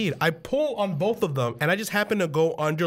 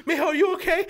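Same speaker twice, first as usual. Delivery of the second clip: high, fretting mother voice; imitated worried mom; 14 semitones higher, faster than usual. Mom checking on crashed son, high-pitched worry. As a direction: pitch it way up with mock concern.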